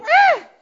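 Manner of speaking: very high, loud mock dismay cry; dismayed groan. Other team groaning as Dave scores, high and loud. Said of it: "the other team would go".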